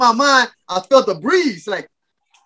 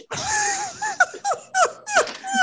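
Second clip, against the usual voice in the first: high squealing laugh; 6 dB quieter than usual. Real, not acted. Loud laugh mid quote.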